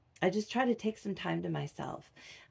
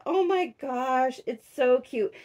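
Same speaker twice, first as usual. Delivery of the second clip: retold gushing; 6 semitones higher, 3 dB louder than usual. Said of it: "I was like".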